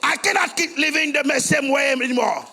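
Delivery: loud, high preacher's cry; preaching at full force. Acted. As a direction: proclaim it to the whole church. Preaching to the congregation.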